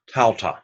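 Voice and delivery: "Tauta" with "au" as the ow in kraut; kraut sound. Shown as a demonstration. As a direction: model "Tauta" clearly, TOW-tah rhyming with "now". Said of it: "diphthong makes the kraut sound".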